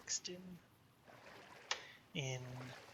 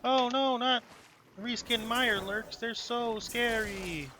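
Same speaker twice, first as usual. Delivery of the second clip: higher, louder hammed-up "oh no"; mock fright; 6 semitones higher, 4 dB louder, slower than usual. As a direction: pretend to be scared, slow and drawn out. Mock fear at game enemies.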